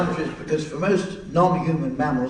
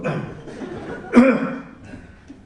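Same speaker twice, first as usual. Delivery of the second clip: cough; 9 semitones higher, 3 dB louder than usual. Real, not acted. Quizmaster halted mid-sentence while reading, coughing. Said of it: "that's me coughing".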